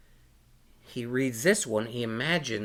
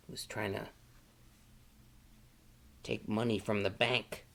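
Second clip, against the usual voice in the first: plain reading voice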